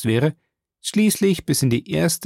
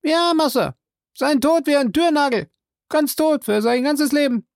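high, loud servant voice calling down; Jupiter's voice; 14 semitones higher, 3 dB louder than usual. Acted. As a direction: pitch him well up and call out. Jupiter answers.

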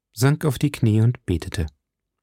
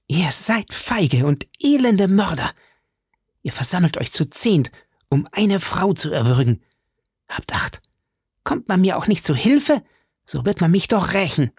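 high-pitched, indignant outcry; crying out; 8 semitones higher than usual. A narrator's reading. Milady, bound, cries out at her captors, high and indignant. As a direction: hurl the accusation in a high, strained voice. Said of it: "brach sie ihr Schweigen und rief".

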